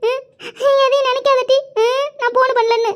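high, tearful whimper; acted crying. Akka pouring out her grief on the phone, crying. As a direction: sob through the words in a high voice. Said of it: "என்னடி அக்கா அழுவுது".

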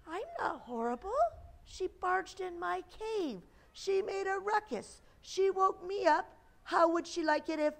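high-pitched, offended storybook bear; put-upon bear protesting his innocence; indignant bear. A narrator's reading. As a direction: complain in a high, wounded voice.

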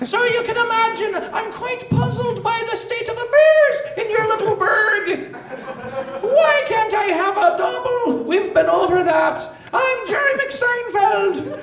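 very high, loud comic Irish whine; hammed-up Irish observational comic; Jerry McSeinfeld, Irish Seinfeld. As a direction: stretch out each puzzled question in mock Irish. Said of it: "I'm an Irish Jerry Seinfeld".

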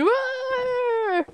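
high, drawn-out mock roar; imitated dog roar. Streamer imitating the possessed-looking dog, high roar. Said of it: "she's like that dog going".

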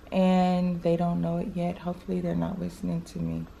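hushed. Real, not acted. Secret surprise, kids might hear.

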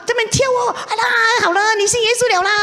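high, loud, tearful cheering; excited shout. Retold or imitated. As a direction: whoop it out, half crying with joy. 朋友激动地宣布信主. (高声激动地哭喊欢呼)

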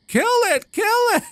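very high-pitched squeal of alarm; high, urgent cry; panicked yelp. Real, not acted. Panicking at rats in game.

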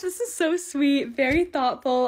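normal talking voice

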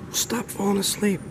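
high, strained scolding without volume; exasperated scolding. Acted. Bride scolding her dozing husband, exasperated.

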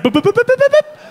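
silly rising popping sounds; comic popping noise. Retold or imitated. Imitated inner sensations.

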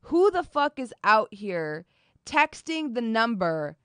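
arguing with her friend, slowly and in disbelief; incredulous rant. Retold or imitated. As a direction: lay out each word in outraged disbelief. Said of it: "I'm like".

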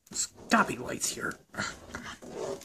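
breathy whisper to himself. Acted. Searching a dark room, whispering to himself. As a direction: hush it while searching.

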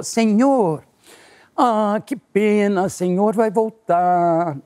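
slow, high, sing-song pity; sorry clerk. Retold or imitated. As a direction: break the bad news sweetly, very slowly. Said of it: "a venezuelana voltou e falou".

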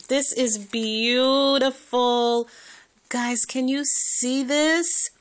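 high, loud, slow drawn-out awe; thrilled gushing. Real, not acted. Vlogger showing viewers the gift, high, slow, thrilled gush. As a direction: stretch every word in delight.

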